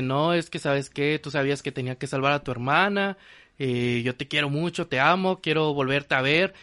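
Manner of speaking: normal talking voice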